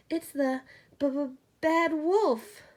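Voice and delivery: scared pig names the wolf, chattering; teeth chattering. A narrator's reading. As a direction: stammer through chattering teeth. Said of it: "Boris chattered".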